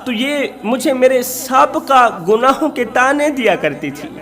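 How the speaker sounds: usual speaking voice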